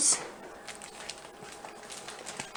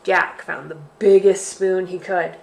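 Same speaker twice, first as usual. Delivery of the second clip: ordinary delivery, nothing marked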